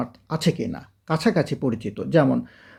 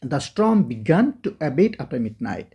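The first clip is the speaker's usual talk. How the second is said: each word of the storm began to abate said very slowly, with storm stressed; slow model reading; much slower than usual. Shown as a demonstration. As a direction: read it out word by word for learners. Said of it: "অন্তত তিনবার পড়তে হবে". (ধীরে ধীরে স্পষ্ট করে পড়া)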